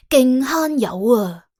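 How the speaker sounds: ordinary delivery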